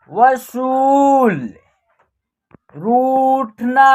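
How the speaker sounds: slow, stretched syllables on a level pitch; drawn-out word drill. Shown as a demonstration. Teacher reading out a Hindi word list for practice, drawn out. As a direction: read each word slowly, holding the long vowels.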